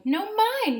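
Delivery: high, whiny little flea; high whining protest. Acted. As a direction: whine it high and small. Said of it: "whined the flea".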